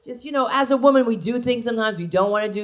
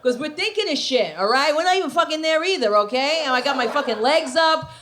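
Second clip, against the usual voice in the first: ordinary talk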